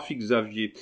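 ordinary delivery

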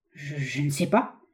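slow, hesitant stammer. A narrator's reading. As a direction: stumble over the first word, unsure. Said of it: "balbua le baronnet". (balbutiement hésitant, lent)

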